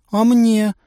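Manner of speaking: put-on high, pleading girl's ask; plaintive. A narrator's reading. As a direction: beg like a hungry girl. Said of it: "жалобно спросила Настя". (жалобно, высоким голосом)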